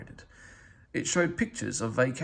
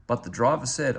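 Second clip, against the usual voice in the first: usual speaking voice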